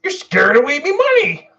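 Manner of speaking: very high, loud, fast cartoon rant; cartoon character voice. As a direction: rattle it off in a loud put-on cartoon voice. Mr. Krabs impression on request.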